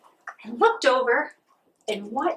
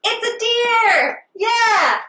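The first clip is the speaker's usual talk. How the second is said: much higher, slow and thrilled; excited reveal; 10 semitones higher, 3 dB louder, much slower than usual. Real, not acted. Teacher revealing the deer track to the kids, delighted. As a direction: announce it like a delightful surprise.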